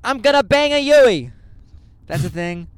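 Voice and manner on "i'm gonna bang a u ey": loud, high Boston driver voice; hammed-up Massachusetts driver, belting it out; Boston accent. Imitating how Massachusetts drivers say roundabout, loud Boston accent. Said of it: "Massachusetts people say things on the road differently".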